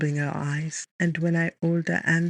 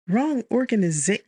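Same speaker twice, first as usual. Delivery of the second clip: incredulous scoff, pitched up; 6 semitones higher, 3 dB louder than usual. A narrator's reading. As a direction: scoff at the word. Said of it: "she scoffs".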